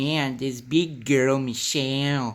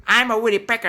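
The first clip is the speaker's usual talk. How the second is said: high puppet voice; 8 semitones higher, 5 dB louder, much faster than usual. Pretend. Woodpecker character.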